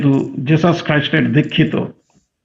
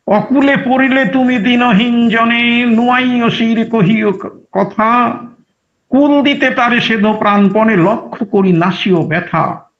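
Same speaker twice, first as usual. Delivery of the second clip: higher, slow devout recitation; poem recital; 7 semitones higher, slower than usual. Acted. Preacher reciting a devotional verse to listeners, slow and lifted. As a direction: lift the verse up slowly like a hymn.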